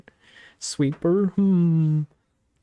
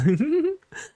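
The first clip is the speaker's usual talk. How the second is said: high giggle; 14 semitones higher than usual. Real, not acted. Laughing at fish bun joke.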